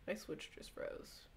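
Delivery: soft, slow, resigned whisper; deflated murmur. Real, not acted. Streamer admits to chat the Switch froze, a soft resigned whisper. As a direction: let it drop, beaten.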